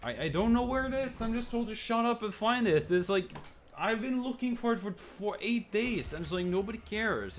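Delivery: high, whiny self-parody; mocking his own complaint, high and whiny. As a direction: whine it like a sulking kid.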